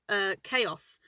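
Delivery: normal talking voice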